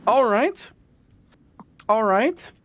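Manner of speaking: high, sing-song teacher reply; imitating the teacher, high and amused; high mock teacher voice. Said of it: "she was like".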